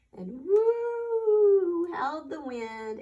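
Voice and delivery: long slow high woo, ghostly; howling wind. Acted. Storyteller imitates howling wind for children, slow ghostly woo. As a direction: stretch the woo like eerie wind.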